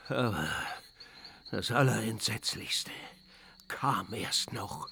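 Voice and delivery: a spooky hush before the worst part; ominous hush. Acted. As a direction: draw the listener in with dread. Schuhu builds suspense.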